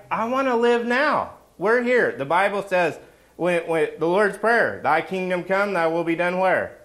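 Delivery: high, loud preacher's climax; rally the congregation with passion; fiery preaching. Acted. Preaching at full force.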